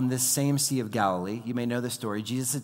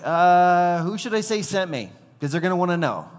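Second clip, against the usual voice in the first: hesitant Moses; 5 semitones higher, 6 dB louder than usual. Retold or imitated. Moses questioning God about who sent him, comically hesitant. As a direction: play a reluctant man stalling.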